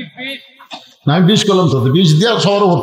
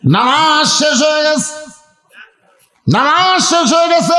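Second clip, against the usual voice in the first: preaching cry; 10 semitones higher than usual. Real, not acted. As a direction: cry it out high to the crowd. Preacher proclaims turn in story. (উঁচু গলায় টেনে ওয়াজের হাঁক)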